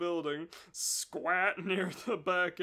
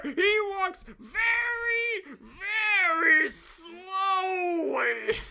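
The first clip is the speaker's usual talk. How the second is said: very high, loud, slow overacted villain rant; dastardly ranting villain; 12 semitones higher, 10 dB louder, slower than usual. Dan ranting about Snotty Boy, loud, high and dastardly. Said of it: "with a dastardly smile".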